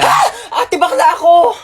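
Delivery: high, hammed-up panicked yell; comic shriek of alarm; mock panicked cry. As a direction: cry out as if under threat, for laughs. Driver panics at passenger.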